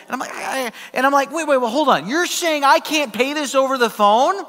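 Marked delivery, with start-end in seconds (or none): 1.3-4.4 high-pitched, indignant disbelief; acted-out rising protest on the phone; a little heated. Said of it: "I start to get a little heated".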